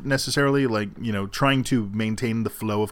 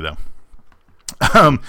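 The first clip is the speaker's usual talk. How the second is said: ordinary delivery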